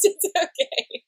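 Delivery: ordinary talk, nothing marked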